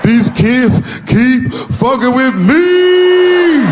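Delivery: high, very slow wailing complaint. Acted. Son whining to dad about bullies, slow high wail. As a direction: drag out a dramatic sob. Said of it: "started crying like he was [name]".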